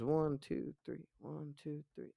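soft, eerie rhythmic counting; creepy rhythmic count. Retold or imitated. A lightly voiced demon counting in a trance, softly. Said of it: "And it goes".

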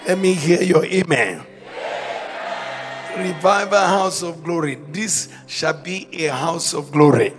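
rising preacher's rally cry; rally speech. Acted. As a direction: proclaim it to the whole church.

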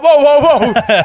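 high, loud whoas broken by laughter; laughing whoa. Retold or imitated. Uncle fending off the niece mid-game, laughing loud high whoas. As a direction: laugh while fending the kids off. Kids pounce again, he laughs.

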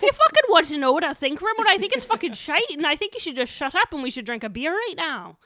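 put-on loud, high-pitched ranting Scottish accent; Scottish husband impression. Husband refusing to talk politics, loud Scottish rant. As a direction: rant like a fed-up Scot. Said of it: "that's my impression of him".

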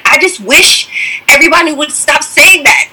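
high, fed-up whine; annoyed retort. Retold or imitated. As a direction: brush it off as tiresome. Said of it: "And I was like".

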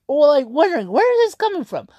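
high, puzzled wondering; baffled. Retold or imitated. Wondering where the streamer's money came from, high and baffled. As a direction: voice jumps up in confusion.

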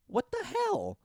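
very high, incredulous outburst; mock outrage. Podcaster yelling at Laurie for not knowing, very high and incredulous. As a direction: scold Laurie in disbelief. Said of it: "I'm just like".

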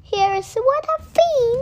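put-on little child excitedly showing mommy; childish squeal. Child voice in story.

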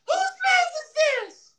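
very high, slow scream. Pretend. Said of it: "I got it".

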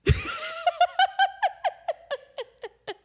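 long, very high cackle; helpless real cackling; real laughter. Real, not acted. Cracking up at her own elevator story, helpless laughter.

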